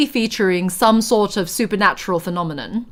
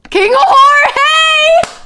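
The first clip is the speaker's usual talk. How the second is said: high, loud mock-Spanish shout; big high-pitched cry of amusement; joking holler; 6 dB louder than usual. Real, not acted. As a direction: holler the name in hilarity. Hollers the king's name, joking.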